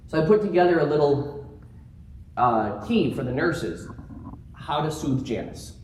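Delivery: ordinary talk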